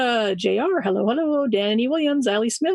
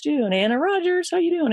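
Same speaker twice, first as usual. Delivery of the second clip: usual speaking voice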